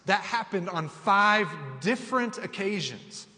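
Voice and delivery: preaching Paul's sufferings to the church, building; preaching scripture. Acted. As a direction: hammer each hardship home. Reads Paul's list with growing force.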